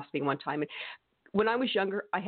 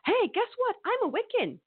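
much higher, bright announcing of being Wiccan; overeager young convert. Retold or imitated. Her younger self announcing she's Wiccan, much higher and eager. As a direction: blurt it out like a proud new convert. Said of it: "I was like a vegan".

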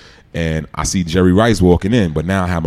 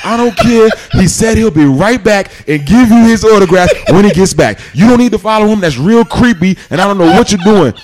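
a Brooklyn mom telling off a stranger, pitched way up; scolding overprotective mom; 14 semitones higher, 7 dB louder than usual. Retold or imitated. Mom scolding Jerry Rice for following her son, high loud scold. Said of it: "My mom's grabs him and is like".

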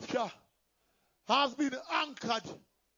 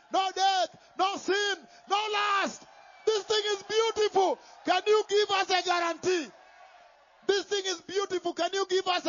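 rising louder and higher list; building preaching climax; 4 semitones higher, 3 dB louder than usual. Real, not acted. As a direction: build it up with each item.

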